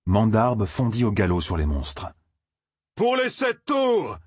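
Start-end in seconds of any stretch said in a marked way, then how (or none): 3.0-4.2 high, drawn-out war cry; battle cry. A narrator's reading. Champion charges the monsters, shouting a drawn-out battle cry. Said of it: "cria le champion".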